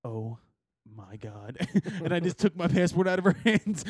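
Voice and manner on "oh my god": low, hushed oh my god; horrified muttered oh my god; soft appalled gasp; quiet disgust. Retold or imitated. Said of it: "she's like".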